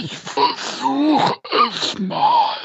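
words on an in-breath, strained and high; talking while inhaling. Shown as a demonstration. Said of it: "einen Satz so zu sprechen beim Einatmen".

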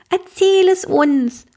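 high, slow, eager demand; a little girl demanding the story. A narrator's reading. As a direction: insist the grandpa tell the story. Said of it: "fordert Globinchen".

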